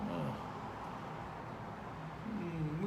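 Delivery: usual speaking voice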